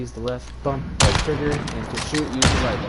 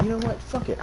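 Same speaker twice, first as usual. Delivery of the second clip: retold exasperation; 10 semitones higher than usual. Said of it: "I was just raging the entire time".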